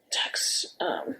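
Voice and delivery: barely voiced, murmuring under her breath while scrolling; whispered to herself. Real, not acted. Searching her phone for old texts, a whispered murmur to herself. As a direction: drop to a private whisper, thinking aloud.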